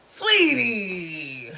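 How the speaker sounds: high, loud drawn-out sweetie; loud exclamation. Real, not acted. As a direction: call it out big and dramatic. Dramatic exclamation after story.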